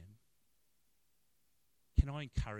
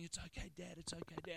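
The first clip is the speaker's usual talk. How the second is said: high, soft, repeated reassurance; hushed, high-pitched daughter consoling; imitating anxious comforting; 9 semitones higher, 5 dB quieter than usual. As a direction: soothe a weeping parent. Daughter comforting her sobbing father.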